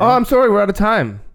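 high, loud joking interruption; mock host cut-off. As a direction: play the host cutting them off.

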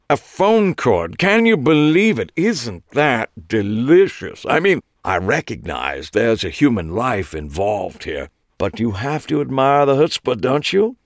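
gleeful British gossip, slowed and high; nasal British Clive. A narrator's reading. Clive relishing the murder news to Eli. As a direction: savour each word like a delicacy.

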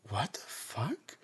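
breathless shocked whisper, soft and slow; stunned whisper. Retold or imitated. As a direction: mutter it in disbelief under your breath. Stunned at what he did.

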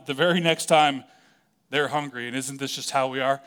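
usual speaking voice

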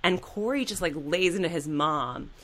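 ordinary talk, nothing marked

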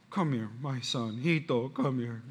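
put-on soft, slow, soothing a crying child; tender comfort. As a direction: gently call the hurt boy over. Comforting crying son after fall.

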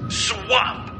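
ordinary delivery

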